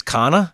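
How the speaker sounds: "Kana" for Connor, ending in "ah"; East Coast pronunciation. Retold or imitated. Host trying guest's name out east, R dropped. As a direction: drop the final R, "Kana". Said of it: "your name out east is".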